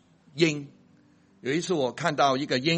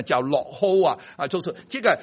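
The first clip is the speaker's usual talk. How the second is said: normal talking voice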